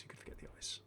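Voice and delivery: hushed, creepy whisper; spooky whisper. Pretend. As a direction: whisper it like a little ghost story. Spooky aside about Krampus eyes.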